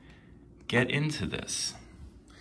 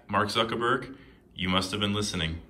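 usual speaking voice

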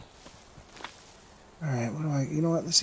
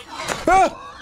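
sudden high breathless yelp; startled scream; 4 dB louder than usual. Real, not acted. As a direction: jump at the scare with a cry. Startled by a snake attack.

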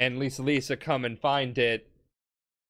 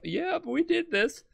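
mock Wamu voice; 13 semitones higher, 3 dB quieter than usual. Wamu quoted, dying proudly.

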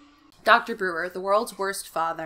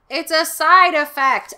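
high, loud and slow; goofy dad voice; 8 semitones higher, 11 dB louder, slower than usual. Acted. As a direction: stretch it out like a silly dad.

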